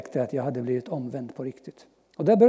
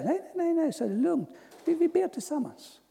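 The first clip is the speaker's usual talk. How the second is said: lightly voiced pastor, higher and gentle; calm pastor; 12 semitones higher than usual. Pastor calming the eager young man, light gentle voice. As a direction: reassure him it's simple. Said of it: "så säger han".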